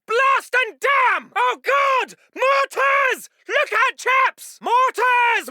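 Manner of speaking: high, drawn-out cries of danger; alarmed warning shout. Acted. Officer warning chaps of mortars, panicked shout. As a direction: yell warnings and curses under fire.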